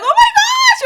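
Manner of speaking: very high, loud mock panic; freaking out. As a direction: squeal it in terror at the knife. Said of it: "I'm, like, freaking".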